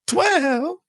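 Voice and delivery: high, loud echo of a shocked cry; imitated high holler; mock shout. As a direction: toss back that stunned yell. Echoing the shocked inmate.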